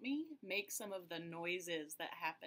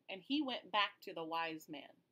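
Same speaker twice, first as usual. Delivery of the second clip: plain reading voice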